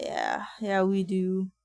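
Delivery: ordinary talk, nothing marked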